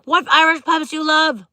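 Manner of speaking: lively mock-eager question; imitating Irish viewer. Quoting someone from Ireland, eagerly imitated. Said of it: "He's like".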